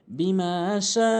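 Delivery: বিমা শা slow, the শা vowel held four beats; four-count madd. Shown as a demonstration. Teacher repeating বিমা শা with a long held vowel. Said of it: "চার আলিফ টেনে পড়ব".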